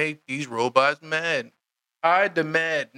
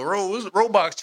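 ordinary delivery

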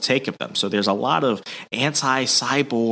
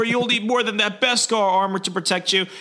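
much higher, put-on threat; hammed-up comic villain line; mock menace; 11 semitones higher than usual. Valance threatening Fett, in a high put-on voice.

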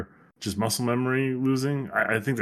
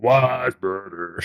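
mock Yoda voice; 2 semitones lower, much slower than usual. Joke Yoda voice continues.

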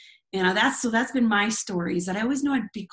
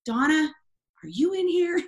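calling out; 8 semitones higher, 3 dB louder than usual. Retold or imitated. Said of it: "I hear this voice".

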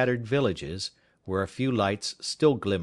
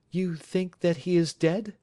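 high, earnest woman pleading for truth; wife begging Holmes for an honest answer; anxious wife; 9 semitones higher than usual. Acted. Wife asks.

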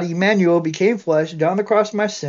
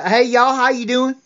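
thick Southern accent; 5 semitones higher than usual. Shown as a demonstration. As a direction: greet them in a thick Georgia accent. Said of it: "I had that thick Southern accent".

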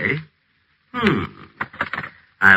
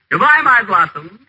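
syrupy farewell; 8 semitones higher, slower than usual. Acted. Syrupy phone farewell to Lorraine.

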